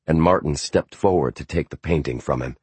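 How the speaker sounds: usual speaking voice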